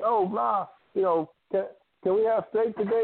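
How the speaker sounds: put-on begging kid. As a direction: wheedle like a kid wanting steak. Kids begging mom for steak.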